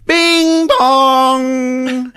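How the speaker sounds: doorbell. Retold or imitated. Telling the movie, doorbell chime imitated high.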